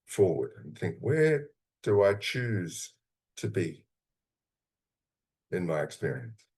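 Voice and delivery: calm, unhurried guiding voice, with long pauses; guided visualization. Acted. Coach guiding listeners into a calm imagined place, gently.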